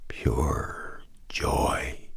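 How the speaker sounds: soft, unvoiced, barely there; mumbled. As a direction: breathe the word out in a weak whisper. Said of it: "he mumbled".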